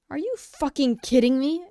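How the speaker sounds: loud, explosive shout of disbelief; yelling in outraged disbelief; furious outburst. A narrator's reading. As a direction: blow up at him in fury. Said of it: "exploded Kacchan".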